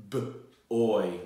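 B-oy segmented into B and oy; sounding out words. Shown as a demonstration. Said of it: "for me to sound out some words".

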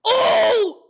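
sudden loud disgusted oh; disgusted shout. Real, not acted. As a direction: yell it in shock. Tastes a gross bean.

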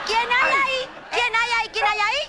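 high, urgent questioning; rapid-fire frightened call; startled challenge. Acted. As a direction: demand who is hiding there, alarmed. Startled by a noise.

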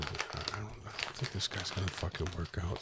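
low, very soft, near-whispered confiding; hushed aside. Pretend. Cohost confers behind another's back about firing him, hushed. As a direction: drop to a secretive undertone.

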